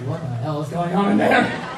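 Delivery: loud "what on earth" reaction; alarmed bewilderment. Retold or imitated. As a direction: call out in disbelief, retelling the shock. Retells reaction to overheard screams.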